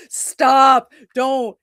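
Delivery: sudden loud "stop"; playful protest. Real, not acted. Streamer telling chat off mid-recommendation, playful shout. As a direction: cut them off with a half-laughing shout.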